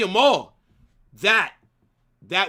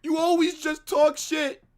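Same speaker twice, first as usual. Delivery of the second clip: high, whining mock complaint; whiny fan imitation; 5 semitones higher than usual. Mimicking a whiny Barca fan in the comment section, higher. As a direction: whine it like an upset fan. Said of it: "Don't be in the comment section".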